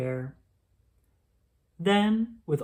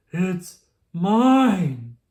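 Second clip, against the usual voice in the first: gasped; 3 semitones higher, 8 dB louder than usual. A narrator's reading. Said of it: "gasped Mister Brown".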